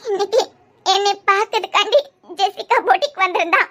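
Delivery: tearful, halting confession; weepy, choked-up admission; crying. Acted. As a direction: get the words out between sobs. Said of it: "பின்ன அழுதுகிட்டே இரு".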